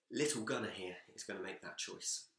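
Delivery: ordinary delivery, nothing marked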